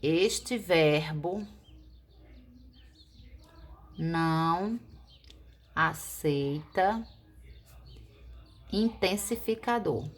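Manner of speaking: very slow, word by word; slow dictation. Shown as a demonstration. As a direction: dictate the important note slowly. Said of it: "observação importante".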